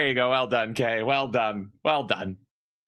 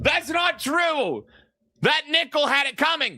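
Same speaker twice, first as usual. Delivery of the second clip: mock outrage; 10 semitones higher than usual.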